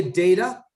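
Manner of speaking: "data" with a long A, as DAY-ta; day-ta variant. Shown as a demonstration. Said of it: "Some people say".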